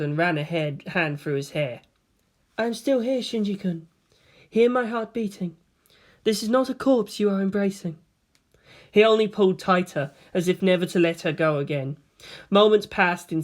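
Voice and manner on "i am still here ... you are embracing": a hint of a higher, gentle voice; calm Rei. Rei comforting the shaking Shinji, calm and even. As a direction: soothe him evenly.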